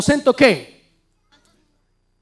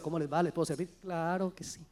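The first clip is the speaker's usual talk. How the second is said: soft and much quieter, unhurried and gentle; calm hushed talk; 7 semitones lower, 17 dB quieter than usual. Pretend. Couple talking over a problem at a restaurant, hushed and calm. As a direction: keep it down, like a private chat at a fine table. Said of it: "mira cómo se comunican, no levantan la voz".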